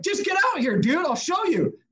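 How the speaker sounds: high-pitched mock exasperation; worked-up, insistent retort; imitated yelling back. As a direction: act out an impatient order without full volume. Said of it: "So I start yelling at him back".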